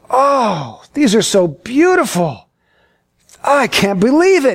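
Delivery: imitated gushing wonder, with a long oh; Debbie's delight. Debbie admiring flowers on a walk, gushing. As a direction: swoon over the flowers, gently hammed-up.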